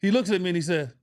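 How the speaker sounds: normal talking voice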